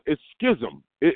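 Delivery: usual speaking voice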